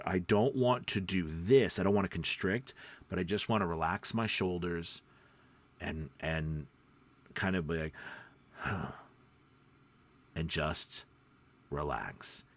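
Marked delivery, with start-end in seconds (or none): 3.1-12.4 deeper relaxed voice. Shown as a demonstration. Voice teacher showing how relaxing deepens the voice, low and relaxed. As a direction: let the voice sink down, relaxed and quiet. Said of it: "my voice is getting deeper".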